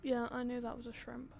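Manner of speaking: ordinary talk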